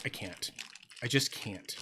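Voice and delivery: quiet, low admission of defeat; overwhelmed, giving up. Retold or imitated. Streamer recalling being overwhelmed by fidgets, hushed surrender. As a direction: drop to a small, low voice. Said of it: "so overwhelmed that I was like".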